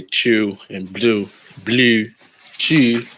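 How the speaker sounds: Baltimore fronted vowel in "chew" and "blue"; Baltimore accent. Shown as a demonstration. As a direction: exaggerate the local "oo" in "blue" and "chew". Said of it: "you know when they say".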